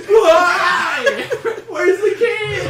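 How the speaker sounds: high, drawn-out hammed-up scream. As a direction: scream it in mock anguish. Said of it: "he's screaming".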